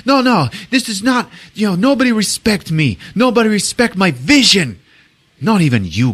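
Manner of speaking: high-pitched mock outrage; imitated pissed-off rant. Director feels disrespected by his friend Greg, ranting angrily. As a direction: rant like a wounded, furious director. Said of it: "he's obviously pissed off".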